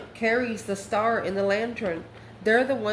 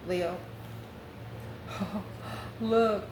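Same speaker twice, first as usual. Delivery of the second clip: ordinary talk, nothing marked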